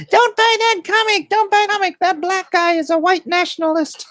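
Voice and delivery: high squeaky mocking whine; shrill little heckler voice, shouted. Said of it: "squeaky little voice in the corner".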